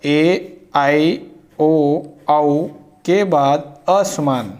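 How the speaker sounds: each vowel stretched slowly and clearly; slow, deliberate teacher recital; sounding out the vowels. Shown as a demonstration. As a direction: read the rule out sound by sound. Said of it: "देखते हैं क्या होता है".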